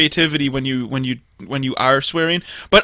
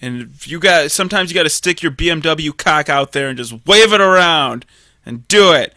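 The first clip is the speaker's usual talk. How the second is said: ordinary talk, nothing marked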